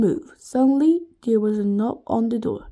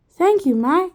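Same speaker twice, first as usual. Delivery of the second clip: villain voice; 6 semitones higher, 8 dB louder than usual. A narrator's reading. Mr Gorf character voice.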